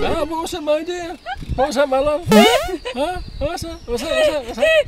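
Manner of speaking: very high, loud granny voice; high old lady voice. Pretend. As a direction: croon it like a fussy old lady.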